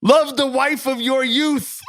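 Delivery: high, solemn preacher-like pronouncement; dramatic reading. Acted. Reading the thread aloud to the hosts, in a high, solemn preacher voice. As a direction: proclaim it like a pastor from the pulpit. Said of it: "a dramatic Jenkins and Jones reading".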